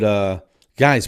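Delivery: ordinary delivery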